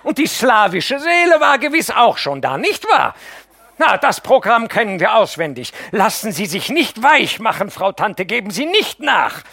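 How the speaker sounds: high, loud, bitter railing; furious tirade. Acted. Mascha warns the aunt against her husband, loud bitter tirade. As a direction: warn the aunt off him with venom.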